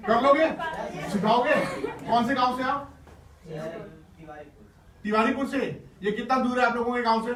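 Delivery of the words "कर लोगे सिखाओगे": rallying call. Real, not acted. Rallying the children loudly.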